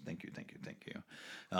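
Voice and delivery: breathy unvoiced whisper; whispered thanks.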